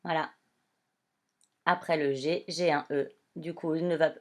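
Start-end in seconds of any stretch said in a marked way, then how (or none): none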